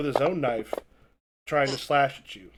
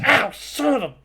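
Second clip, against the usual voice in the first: mock pained yelp; 9 semitones higher, 4 dB louder than usual.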